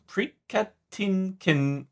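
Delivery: slow, stretched-out Prikatinkin; attempting a name. Shown as a demonstration. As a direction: sound out Prikatinkin syllable by syllable. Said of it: "Did I say that right?".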